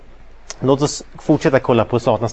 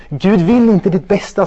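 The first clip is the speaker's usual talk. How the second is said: higher, louder insistent devil's claim; Satan's lie; 7 semitones higher, 6 dB louder than usual. Acted. Satan telling the listener God wants him to suffer, insistent. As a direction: push the lie hard like a tempter. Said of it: "säger Satan".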